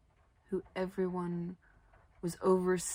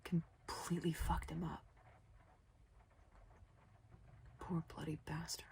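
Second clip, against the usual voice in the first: hushed whisper; 9 dB quieter than usual. A narrator's reading. Grim reflection on father's trauma.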